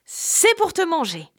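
a high, final threat from the wolf; the wolf springs the trap; menacing wolf. A narrator's reading. Le loup révèle sa menace. (le loup révèle enfin sa menace)

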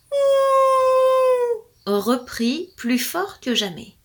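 slow, high howling words; howled line. A narrator's reading. Storyteller howling as the noise returns. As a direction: stretch it like a howl. Howl louder than ever.

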